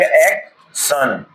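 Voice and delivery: एक सन said very slowly and drawn out; teacher drags out एक सन slowly; slow sounding out. Shown as a demonstration. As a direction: stretch एक सन so learners hear each part. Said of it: "तो क्या हो जाएगा".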